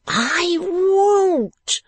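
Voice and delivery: very high, wailing shriek; screamed refusal. A narrator's reading. Woman refusing to be calm, screaming. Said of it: "screamed the woman".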